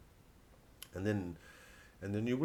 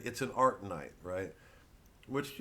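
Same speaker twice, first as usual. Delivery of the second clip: usual speaking voice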